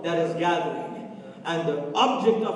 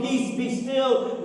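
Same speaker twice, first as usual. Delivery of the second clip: higher, slow, commanding "Peace, be still."; Jesus commanding peace; 7 semitones higher, 4 dB louder, slower than usual. Retold or imitated. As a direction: speak it as Jesus calming the storm. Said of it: "he speaks into your storm".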